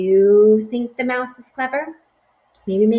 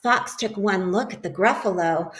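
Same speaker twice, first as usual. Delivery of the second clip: plain reading voice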